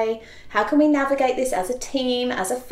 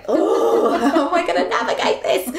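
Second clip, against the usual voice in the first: long "oh" breaking into laughter; high, quick, laughing worry; laughing mock dismay; 6 semitones higher, 4 dB louder than usual. Mum reacting to her son choosing school, laughing mock dismay. Mum's retold dismay at son's choice.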